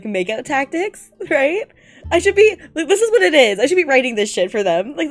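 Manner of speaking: normal talking voice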